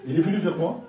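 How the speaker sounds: ordinary talk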